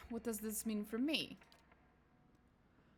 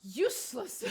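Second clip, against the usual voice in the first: scornful spirit; 3 semitones higher, 6 dB louder, slower than usual. Acted. The spirit dismissing the scholar's theory, scornful. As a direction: fling the word at the scholar in contempt.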